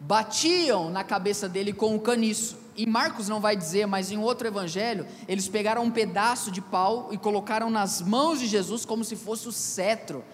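normal talking voice

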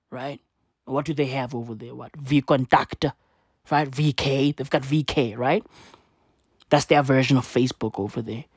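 ordinary talk, nothing marked

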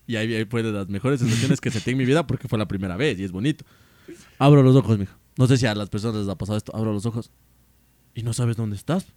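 ordinary delivery

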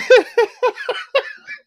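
high, loud burst of laughing; helpless laughter. Real, not acted. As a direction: lose it completely mid-story. Cracks up at own confession.

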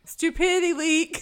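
high, loud hammed-up insult; playful imitation of a scornful jab; mock taunt. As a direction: fling it out half laughing. Retold insult from the film.

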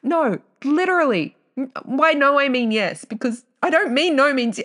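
high, loud and rushed; flustered excitement. Real, not acted. Correcting herself to listeners, high and flustered. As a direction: scramble to fix what you said.

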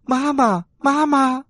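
put-on high, loud childlike cries; excited little tadpole shout; repeated loud calling. A narrator's reading. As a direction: shout for mama again and again, up high. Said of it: "连声地大喊".

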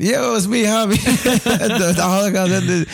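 high, giggly mock-assistant voice; joke voice assistant. Friend playing a personalized assistant answering, joke assistant voice. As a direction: announce it with absurd cheer. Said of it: "an assistant that sounded like me".

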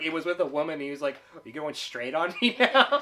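loud, high quotes through laughter; amused imitation, voice lifted with laughter; laughing retelling. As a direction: act out the exchange while cracking up. Retelling funny dialogue, laughing.

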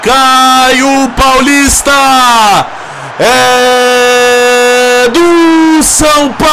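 long drawn-out goal yell; explosive goal commentary. Real, not acted. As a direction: stretch the goal call, shouting. Goal scream.

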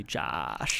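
"Josh" low, with a broad A; American vowel. Shown as a demonstration. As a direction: drop low and open "Josh" into a long "Jahsh". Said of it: "With a, with an A, basically".

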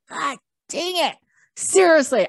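high exasperated outburst; retold boiling frustration. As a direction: act out losing patience behind the wheel. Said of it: "feel my blood pressure boiling up".

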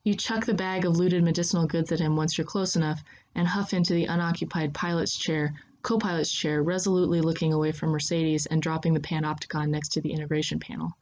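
ordinary delivery

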